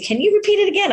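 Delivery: a hint of a teacher's high-pitched, insistent question; retold teacher. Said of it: "And he said".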